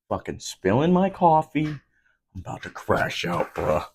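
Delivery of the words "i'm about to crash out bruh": low breathy mock threat to lose it; mock meltdown. Spilling coffee, jokingly threatening to the camera, mock menace. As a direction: fake a quiet crash-out. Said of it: "I'm just kidding".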